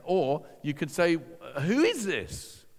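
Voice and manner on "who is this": raised, drawn-out question of wonder; astonished inner questioning; amazed questioning. Retold or imitated. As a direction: ask it slowly, high, in amazement.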